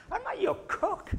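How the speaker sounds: high put-on wife voice, firm correction; wife's indignant retort. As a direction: snap back as the offended wife. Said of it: "She'd say".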